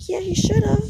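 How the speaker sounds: high, loud, mock-indignant "you should've"; sassy comeback. Boyfriend scolding friends who set him up, high and sassy. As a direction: toss it back with playful sass. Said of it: "And he was like".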